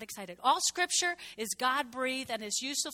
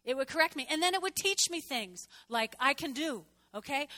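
normal talking voice

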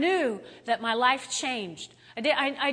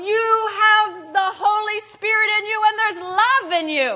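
higher, louder shouted reassurance; yelling; 9 semitones higher, 8 dB louder than usual. Retold or imitated. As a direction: shout love at the kids. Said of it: "I was trying to tell them".

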